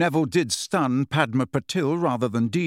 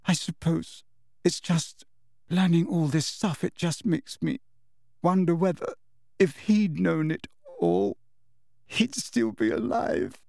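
put-on tearful girl; 5 semitones higher than usual. A narrator's reading. Cho, crying to Harry about Cedric, higher and halting.

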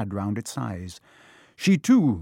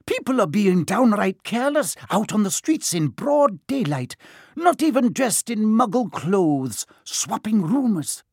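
higher, crisp stern Scottish teacher; a prickly old witch caught out, higher; startled stern witch, pitched up; prim Professor McGonagall; 8 semitones higher, 3 dB louder than usual. Acted. McGonagall scolds.

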